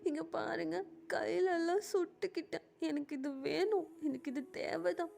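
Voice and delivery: acted crying. Jimin confiding rejection to grandmother, tearful. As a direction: pour out the hurt through acted sobs. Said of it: "செம்மையா அழுதுட்டு இருக்காரு".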